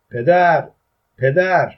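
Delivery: high, loud repeated call; shouting. A narrator's reading. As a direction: call out to catch someone's attention. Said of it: "من داد زدم".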